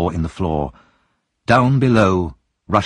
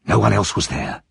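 ordinary delivery, nothing marked

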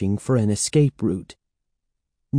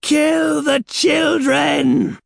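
high, slow, screamed command; loud, exultant villainous shriek; triumphant scream; 5 dB louder, much slower than usual. A narrator's reading. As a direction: scream the order in triumph, stretching each word. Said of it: "Sarah Beth screamed triumphantly".

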